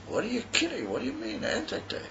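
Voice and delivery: a replayed moment of mild shock, voice lifting; incredulous question. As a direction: ask it as if he can't believe it, pitch up. Retold disbelief at jogger's news.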